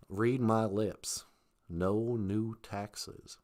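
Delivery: very slow, low, soft politician; hushed, drawn-out presidential impersonation; George Bush Senior. Acted. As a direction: stretch each word, deep and quiet. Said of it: "There was George Bush Senior".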